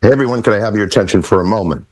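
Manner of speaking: normal talking voice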